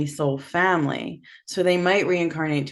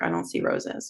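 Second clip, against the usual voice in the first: lower, flat skeptical voice; doubtful client; 11 semitones lower, 5 dB quieter than usual. Retold or imitated. A client who sees no roses, lower and doubtful. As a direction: play the unconvinced client.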